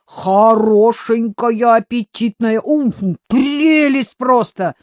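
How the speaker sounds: loud, high, drawn-out relish; slow, delighted admiration; admiring. A narrator's reading. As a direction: gush over her, savouring the words. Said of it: "восхитился Иван".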